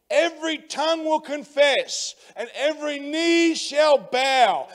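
loud, high fired-up preaching; rally the congregation, full voice; loud, driving revival preaching; preaching at full force. Acted.

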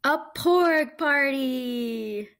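delighted slow announcement; excited reveal. A narrator's reading. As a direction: stretch it out like unveiling a big surprise. Narrator reveals surprise party.

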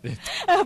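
loud, high burst of laughter; high cackling laugh; hard laughter. Acted. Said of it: "làm gì mà cười dữ vậy".

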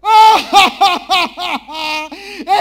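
loud, high acted belly laugh; audience guffaw. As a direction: imitate a studio bursting into laughter. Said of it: "Όλο το ακροατήριο να γελάει".

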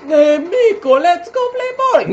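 very high, loud put-on cartoon voice; silly high voice. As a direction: squeak it like a cartoon cousin. Mocking voice of game cousin.

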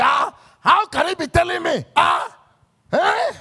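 high indignant shout; mock outraged shout. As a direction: scoff loudly like an offended member. Mocking members who object.

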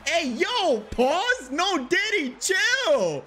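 high, thin put-on voice; squeaky mock voice. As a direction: jump way up into a goofy falsetto. Mock reaction to his own joke.

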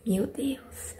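drowsy whispered sigh; sleepy whisper. Real, not acted. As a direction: whisper it, half asleep. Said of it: "Eu tô morrendo de sono".

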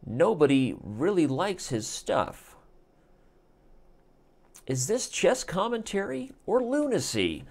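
dramatic investigative TV promo read, slow in places; mock news promo. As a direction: tease the exposé like a tabloid news anchor.

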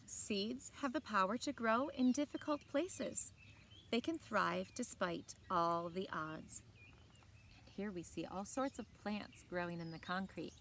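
put-on reading a picture book aloud to children; bright, clear read-aloud voice; story narration. A narrator's reading. Teacher reading a book to the class, in a storytelling voice.

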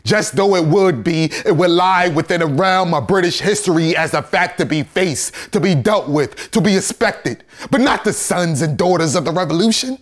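high, loud, mounting indictment; building oration. Acted. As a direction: build clause by clause to a ringing peak.

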